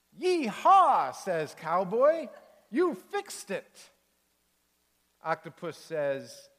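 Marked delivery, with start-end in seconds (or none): 0.1-1.1 loud, high cowboy whoop; Cowboy cheering as the teeter-totter works, a loud high whoop; cowboy yee-haw. Acted. 2.7-3.9 high, slow, amazed cowboy voice; Cowboy praising Octopus, high and slow with delight. Acted.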